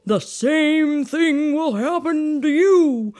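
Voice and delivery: loud, booming spectral voice; ghost voice. Acted. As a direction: moan like a guilty ghost. Voicing Marley's ghost.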